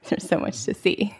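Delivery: amused, breaking into a small laugh; laughing lightly. Real, not acted. Meditation teacher joking about open-eyed practice, laughing lightly. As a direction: say it through a light chuckle.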